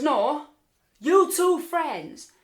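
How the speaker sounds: high, loud, drawn-out repeat; imitated guard's pointed interrogation, stretched out; insistent questioning. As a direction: press the question as a suspicious guard. Guard pressing her suspicious question.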